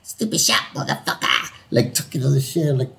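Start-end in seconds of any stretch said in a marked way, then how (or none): 0.0-1.5 high, hammed-up Aussie trash talk; badly faked Australian heckling; rough attempt at an Aussie accent; horrible Australian accent. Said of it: "my horrible Australian accent".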